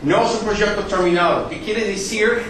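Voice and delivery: usual speaking voice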